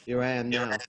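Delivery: usual speaking voice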